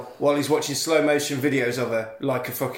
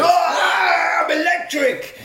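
hammed-up yell, much higher and louder; mock electrocuted shout; 5 dB louder, much slower than usual. Teen getting zapped through the phone, hammed-up shout. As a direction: scream it like you're being zapped. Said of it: "he goes".

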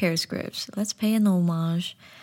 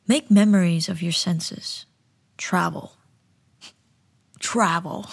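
tagline read with weight; commercial voiceover. Acted. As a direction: close the ad with a hook.

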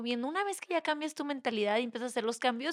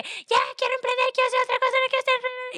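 much higher, whiny restless voice; impatient younger self; 13 semitones higher, 5 dB louder than usual. Retold or imitated. Imitating her younger self telling mom she wants change, high whine. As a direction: whine it like a fed-up kid wanting out. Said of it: "ya estaba de que".

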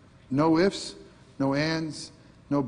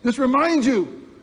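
higher and louder, pushing it right up close; mock in-your-face jab; 9 semitones higher, 5 dB louder than usual. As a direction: shove the point in their face, playfully. Preacher mock-confronting in face.

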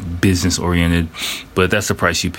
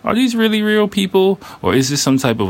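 doubtful; 13 semitones higher than usual. Retold or imitated. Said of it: "doubting to myself".

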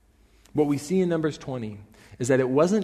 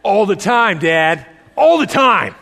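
put-on higher, louder taunting child voice; defiant child; 8 semitones higher, 11 dB louder, slower than usual. Toddler taunting her father about the potty, sing-song defiant. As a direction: taunt dad like a cheeky toddler. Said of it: "this'll get him".

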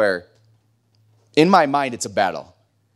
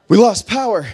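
sudden startled call as the lights drop; mock alarm; 8 semitones higher, 7 dB louder than usual. Real, not acted. Pastor to the congregation as lights cut, mock startled. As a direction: fake panic at the dark.

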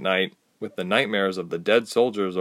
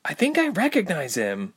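crying out; 8 semitones higher than usual. Acted. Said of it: "Ilse cried".

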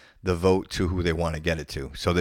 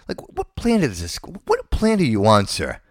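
incredulous; 4 semitones higher, 5 dB louder than usual. Real, not acted. Mocking a candidate's statements.

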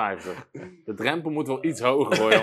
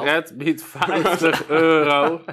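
laughing; 4 semitones higher, 6 dB louder, much slower than usual. Real, not acted. Announcing a bid in the auction.

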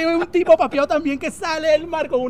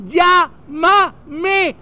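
louder, broken into drawn-out syllables: "Llá-ma-me"; spelled-out call; 3 semitones higher, 5 dB louder than usual. Acted. Honeymooner repeating for a friend who cannot hear, calling out.

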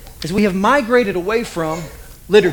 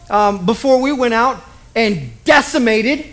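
usual speaking voice

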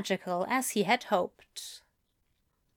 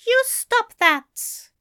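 high, slow, louder scold; 13 semitones higher, 6 dB louder, slower than usual. A narrator's reading. The angel scolds Crowley for self-blame, firm and high. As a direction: scold him firmly but fondly. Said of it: "the angel scolded".

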